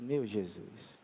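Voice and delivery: low murmur of retold shock; hushed dismay. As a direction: drop low and quiet, taking in bad news. Shocked reaction to injury photo.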